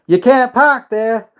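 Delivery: high, loud, drawn-out Aussie call; mock Australian accent. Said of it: "two kangaroos go and attack him".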